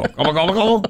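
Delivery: high turkey gobble; gobbling turkey voice. Pretend. Turkeys answer the pretend police.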